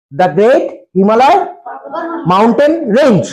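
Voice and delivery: very slow, word by word; slow dictation. Shown as a demonstration. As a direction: spell it out slowly for the class. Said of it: "teacher dictating slowly". (ধীরে ধীরে বলা)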